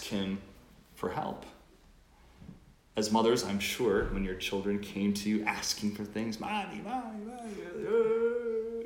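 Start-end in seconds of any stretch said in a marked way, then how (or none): 6.4-8.8 put-on high whining kid calling Mommy; whiny child voice. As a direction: pester like a little child. Child pestering mother imitated.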